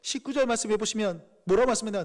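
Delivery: ordinary delivery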